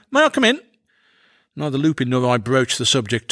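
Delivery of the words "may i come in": high, loud-ish call-out; pitched-up cheery hail; intruding visitor. A narrator's reading. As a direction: sing out brightly on barging in. Going's usual trick on entering.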